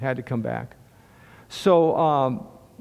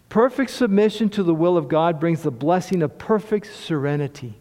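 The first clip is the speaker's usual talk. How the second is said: ordinary talk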